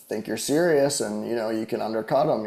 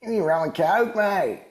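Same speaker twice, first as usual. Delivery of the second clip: a much higher, louder hammed-up Australian accent; barfly ordering a drink in a cartoonish Australian accent; hack Australian accent; 10 semitones higher, 3 dB louder than usual. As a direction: order a rum and coke in an exaggerated Aussie accent. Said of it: "the hack Australian accent".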